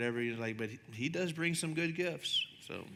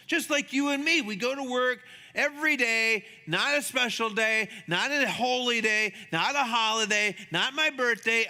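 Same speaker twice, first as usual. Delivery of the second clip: preaching emphasis; 9 semitones higher, 6 dB louder than usual. Real, not acted. Preacher building to the point.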